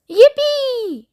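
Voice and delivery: very high whooping yippee; joyful shout. Acted. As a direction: whoop like an old man on a ride. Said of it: "shouted Grandpa Joe".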